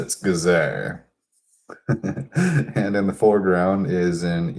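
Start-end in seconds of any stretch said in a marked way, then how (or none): 0.0-1.0 Gizeh with a stressed final zay. Shown as a demonstration. Correcting a listener's Giza to Gizeh. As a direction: land firmly on Gizeh, geh-ZAY. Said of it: "it's not Giza".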